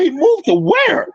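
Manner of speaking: high, stretched incredulous question; astonished, as if hearing wild news; mock disbelief. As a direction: replay your shock, pitch jumping up and drawn out. Retells his shock at the news.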